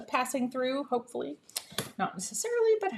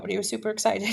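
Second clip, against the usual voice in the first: usual speaking voice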